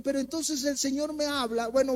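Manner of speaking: ordinary talk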